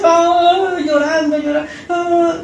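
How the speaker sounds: high mock wailing of hired mourners. Preacher mimicking hired mourners wailing, mock wail. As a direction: ham up the professional criers, pitched high.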